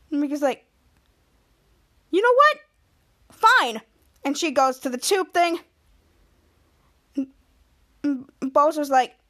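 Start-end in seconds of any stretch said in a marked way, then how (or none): 2.1-3.8 high, loud huffy retort. Retold or imitated. Mika, kicked out by Ray, snaps back huffily. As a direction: snap it back and storm off.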